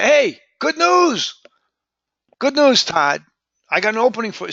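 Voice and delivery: high, excited lift on "good news"; punched-out excitement. Shown as a demonstration. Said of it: "you really wanna punch".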